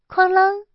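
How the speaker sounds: loud, high-pitched clatter noise; high, loud crash sound effect; imitated clang. A narrator's reading. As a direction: ring out a sudden metallic clang. Narrator imitates ladle clattering down.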